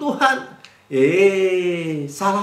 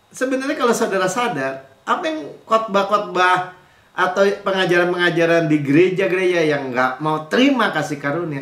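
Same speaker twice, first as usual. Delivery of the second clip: usual speaking voice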